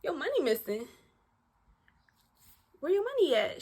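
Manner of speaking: high, startled questions; incredulous about the missing money; shocked echo. Retold or imitated. She echoes friend's alarm.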